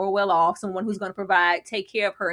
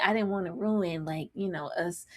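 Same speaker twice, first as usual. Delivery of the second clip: much softer trailing plea; mock soft excuse; 3 semitones lower, 9 dB quieter than usual. Man excusing himself to his girlfriend, softly mocked. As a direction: fade to nearly nothing.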